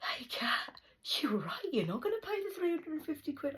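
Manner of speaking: high put-on voice; imitated questioner. Retelling someone asking about the money, high mimicking voice.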